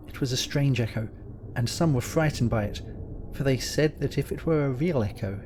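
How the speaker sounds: ordinary delivery, nothing marked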